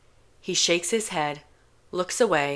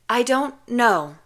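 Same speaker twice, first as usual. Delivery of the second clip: higher, slow guilty admission; higher, hesitant confession; sheepish; 6 semitones higher, 5 dB louder, slower than usual. Acted. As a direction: admit it awkwardly.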